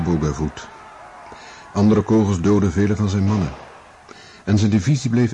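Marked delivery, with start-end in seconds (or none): none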